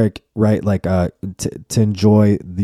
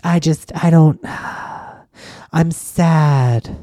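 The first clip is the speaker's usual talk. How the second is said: high whiny put-on voice with a sigh; mock a friend moping; mopey sad friend; 8 semitones higher, slower than usual. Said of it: "If somebody fucking tries to tell you".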